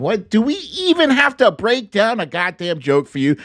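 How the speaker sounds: normal talking voice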